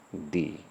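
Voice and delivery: "दी" with a long ee, like "thee". Shown as a demonstration. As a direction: stretch the vowel in "दी". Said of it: "तो ये हो जाएगा". ("दी" लंबी ई के साथ)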